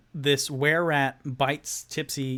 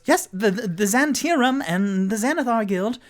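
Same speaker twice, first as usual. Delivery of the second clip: higher, louder eager voice; Rock's voice; 9 semitones higher, 6 dB louder than usual. Acted. Rock adding the guild names, high and eager. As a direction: jump in eagerly to help. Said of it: "And then Rock goes".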